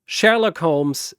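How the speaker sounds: usual speaking voice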